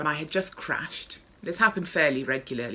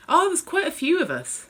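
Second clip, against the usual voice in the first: cheerful gossip; 13 semitones higher, 6 dB louder than usual. Acted.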